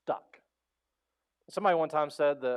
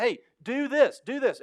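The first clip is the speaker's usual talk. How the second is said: louder, higher mock command; urgent orders; 9 semitones higher, 5 dB louder than usual. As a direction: snap orders to the crew, high and loud. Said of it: "So he's trying to tell them".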